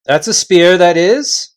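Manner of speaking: chief voice, proud. Acted. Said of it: "said the chief voice".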